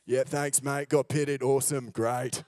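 put-on a light Australian surfer accent; a casual Aussie surfer accent, lightly done. Surfer chatting on the waves in a light Australian accent. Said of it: "I put on an Australian accent when I'm surfing".

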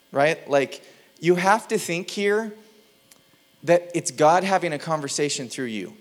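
ordinary delivery

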